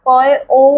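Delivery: put-on name the letter slowly for children; letter name sounds. Shown as a demonstration. Teacher naming letters for young kids, clearly. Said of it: "অনুস্বারের উচ্চারণটা".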